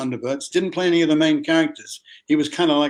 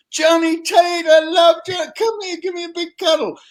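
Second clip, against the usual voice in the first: very high, loud, gushing welcome; gushing delight; 8 dB louder than usual. Retold or imitated. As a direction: shriek with joy at an old friend's son. Producer gushes over his father.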